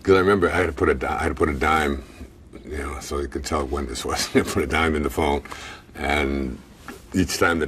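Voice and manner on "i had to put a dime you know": usual speaking voice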